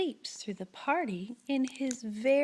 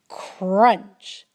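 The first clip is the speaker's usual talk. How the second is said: plain reading voice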